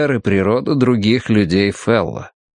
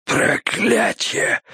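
slow, unvoiced rasp of agony; pained wheeze; 10 semitones higher, much slower than usual. A narrator's reading. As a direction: wheeze the curse out through pain. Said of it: "прохрипел он". (мучительный хрип)

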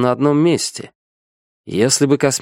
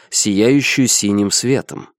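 plain reading voice

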